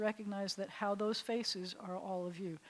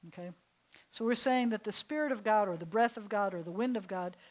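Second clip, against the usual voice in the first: normal talking voice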